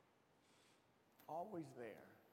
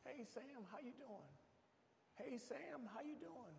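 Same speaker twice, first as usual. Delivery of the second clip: mock cheery greeting; 11 semitones higher, 5 dB quieter than usual. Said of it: "I would come outside".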